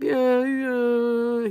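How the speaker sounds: high drawn-out mock sob; imitated crying. Retelling her tearful answer to him, imitated crying. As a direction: whimper the words as if already in tears. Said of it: "I'm crying".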